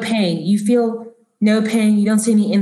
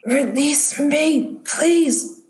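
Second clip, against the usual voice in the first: halting, higher-pitched rasp, word by word; slow raspy drone turning to a high, drawn-out plea; 6 semitones higher, much slower than usual. Acted. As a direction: beg in a strained, entranced rasp. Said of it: "he's still rasping".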